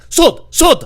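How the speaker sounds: loud, high one-word bursts; odd store customer. Retold or imitated. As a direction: blurt it high like a baffling customer. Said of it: "this guy came up to me".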